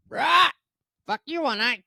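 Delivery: loud, very high parrot squawk. Acted. Parrot answering its new owner, high loud squawk. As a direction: screech it like a cursing parrot.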